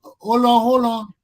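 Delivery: loud, high urgent hold on. Real, not acted. Host halting a noisy call, loud and urgent. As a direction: call out to stop everyone talking.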